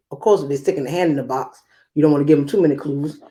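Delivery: ordinary talk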